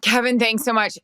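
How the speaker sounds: ordinary talk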